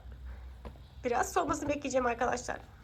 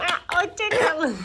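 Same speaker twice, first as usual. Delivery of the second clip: laughing high and loud; bursting into laughter on goodbye; 6 semitones higher, 9 dB louder, slower than usual. Real, not acted.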